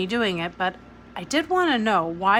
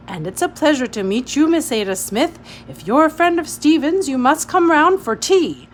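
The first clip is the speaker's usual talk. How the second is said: put-on hearty old colonel; 7 semitones higher, 9 dB louder than usual. Colonel cheerful formal welcome.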